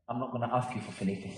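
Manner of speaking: a hint of a small boy, hushed and fast; soft childlike murmur with a laugh; small son's voice. Five-year-old son voiced in story.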